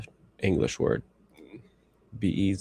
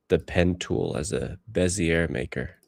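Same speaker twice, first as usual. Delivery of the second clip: normal talking voice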